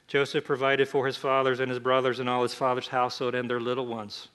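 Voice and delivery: normal talking voice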